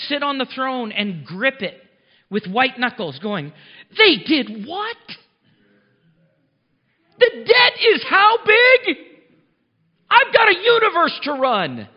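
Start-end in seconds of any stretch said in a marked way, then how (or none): 4.0-11.9 much higher and louder, slow, hammed-up alarm; mock panicked God. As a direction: play a flustered God gripping the throne, cracking into a laugh.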